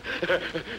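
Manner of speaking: a light rising laugh; soft happy laugh; amused laugh. Acted. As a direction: laugh it off with fun. Friend laughs at the joke.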